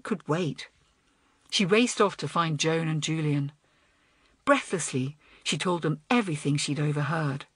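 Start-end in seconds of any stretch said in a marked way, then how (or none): none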